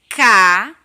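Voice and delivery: Ca punched very loud; syllable beat. Shown as a demonstration. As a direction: hit the syllable Ca hard. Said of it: "Coloca o dedinho no queixo".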